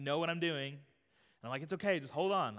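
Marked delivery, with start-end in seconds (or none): 1.6-2.6 put-on louder, higher parent calming a toddler; raised-voice retold reassurance; urgent reassurance. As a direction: imitate a parent hurrying to stop the crying. Imitates himself soothing crying toddler.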